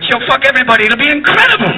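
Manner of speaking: put-on high, excited Italian accent. Italians cheering on a porn star candidate, high Italian accent. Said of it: "Even the Italians are going".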